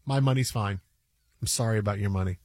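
deep-voiced unbothered brush-off; evil banker. Banker tells client his own money is fine, deadpan.

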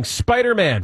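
mild mock yell, pitched high; retold shout. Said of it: "It took someone shouting".

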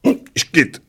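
high, spluttered fake hiccup. A narrator's reading. Little old man trying to hold his breath, spluttered hiccup. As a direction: hiccup out a small high burst. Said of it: "vyprskl maličký".

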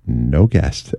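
low, quiet and flat; low flat producer voice. Retold or imitated. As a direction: deliver bad news deadpan, low. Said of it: "they say".

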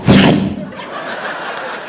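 long voiceless whoosh of fish fleeing; mouth-made swoosh; whooshing getaway noise. Retold or imitated. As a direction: imitate fish darting away with a rush of air. Imitates little fish fleeing.

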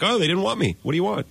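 high-pitched imitation of playing the victim; mock innocence. Imagining the star shifting blame after a trade, mock innocence.

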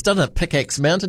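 ordinary delivery

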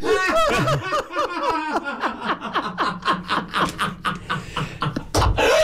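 long high helpless laugh; giggling fit; burst of laughter. Real, not acted. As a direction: crack up at the botched joke. Laughs at failed joke.

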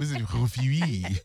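Rufiwi, a playfully Frenchified name ending in wee; French accent. Pretend. Host teasing the co-host with a French-accented name, French accent. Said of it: "love the French accent".

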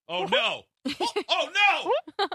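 high, laughing oh no; mock panic. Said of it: "Like you".

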